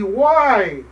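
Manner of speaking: put-on kneeling old man crying out to the sea; desperate cry.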